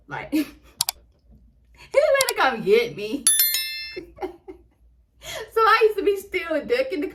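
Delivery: laughing through the words. Real, not acted. Telling viewers the stalker is coming, laughing. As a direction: laugh at her own fear.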